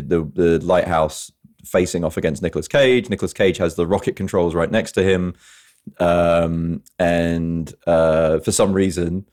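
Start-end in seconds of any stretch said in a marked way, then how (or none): none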